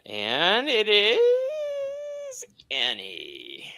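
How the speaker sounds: high, very slow reveal; suspenseful singsong reveal; drawn-out announcement. Real, not acted. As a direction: stretch it out high like announcing a winner. Announcing whose name was pulled.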